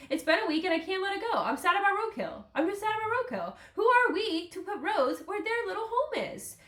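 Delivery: high-pitched, plaintive lament; heartbroken. Real, not acted. Podcaster lamenting roadkill to listeners, high and heartbroken. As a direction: protest in a high, upset voice. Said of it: "I'm heartbroken about that".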